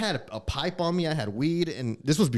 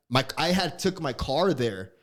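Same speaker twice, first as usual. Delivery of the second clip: ordinary talk, nothing marked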